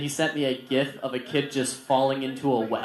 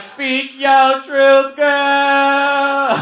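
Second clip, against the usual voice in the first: high, loud, drawn-out cry; hugely stretched supportive shout; cheering her on; 10 semitones higher, 8 dB louder, much slower than usual. Retold or imitated. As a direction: belt it out slowly like a hype man. Teacher cheering student's breakup text.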